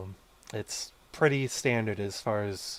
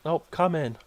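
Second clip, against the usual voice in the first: lightly voiced older woman, higher; 6 semitones higher, 5 dB louder, faster than usual. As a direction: call it out like an old lady. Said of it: "an older woman's voice, uh, say".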